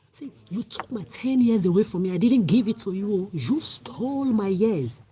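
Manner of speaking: loud, bitter, furious accusation; shouting in real anger at Italy; angry outburst. Real, not acted. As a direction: throw the stolen years back at them, loud. Raging at those who stole years.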